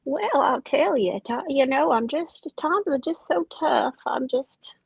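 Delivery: ordinary talk, nothing marked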